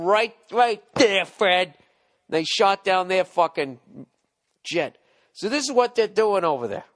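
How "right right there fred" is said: mock tough quip. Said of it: "gave him the old".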